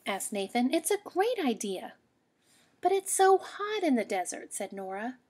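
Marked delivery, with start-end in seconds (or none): 0.7-2.0 put-on high, bright pleading kid voice; child rabbit backing up the plan; eager child. 2.8-4.5 put-on much higher, louder kid voice; little sister rabbit complaining about heat; high child objection.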